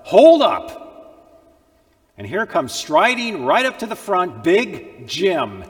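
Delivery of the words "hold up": loud, high, husky shout; big kid stopping the whipping, shouting; husky cry. Acted. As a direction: call out from the back to stop it. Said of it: "a husky voice from the back of the room cried out".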